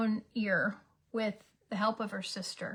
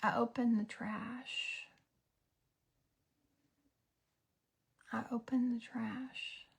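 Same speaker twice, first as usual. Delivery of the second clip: hushed reveal; 8 dB quieter, slower than usual. Retold or imitated. Telling viewers she found the food in the trash, whispering. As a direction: whisper it, slowing down.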